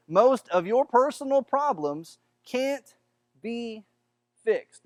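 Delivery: slow, each word set apart with pauses; slow deliberate emphasis with long gaps before be and fixed; really slowly. Shown as a demonstration. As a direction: drag it out word by word so it sinks in. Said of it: "I'm gonna say that again really slowly".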